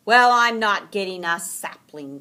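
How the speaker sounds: loud stubborn declaration; Franklin declaring. A narrator's reading. Franklin refuses a sapling, loud and firm. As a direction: put your foot down loudly. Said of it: "Franklin declared".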